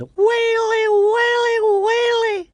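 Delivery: very high, loud repeated lament; wailing shout. A narrator's reading. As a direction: shout in woe as tiny panicking men.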